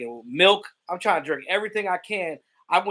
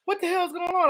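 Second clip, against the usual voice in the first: much higher, startled mother asking what's wrong; mom's alarmed voice. Retold or imitated. Mother bursts in, alarmed by her son's yelling, high startled voice. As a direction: squeal it like a shocked mom at midnight. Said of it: "She's like".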